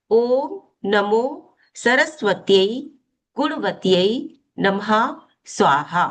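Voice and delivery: drawn-out mantra, word by word; measured syllables with a pause between words; slow recitation. Shown as a demonstration. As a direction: chant it slowly for learners to follow. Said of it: "धीरे धीरे बोलूंगी ताकि समझ में आ सके".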